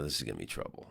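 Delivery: low, soft aside to himself; retold wary thought, dropped right down; muted inner thought. Comedian sizing up the woman's attitude, muted wary aside.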